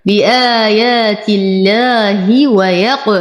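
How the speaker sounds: long vowels, then an echoing release on ق in ويق-; qalqalah. Shown as a demonstration. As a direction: chant بآيات الله ويق- slowly, bouncing the final ق. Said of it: "أقلقل القاف". (ترتيل بآيات الله ويق- مع قلقلة القاف)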